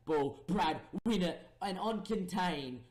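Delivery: high, loud barked commands; urgent shouting. Real, not acted. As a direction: yell at the players to win it back. Urging players to win ball.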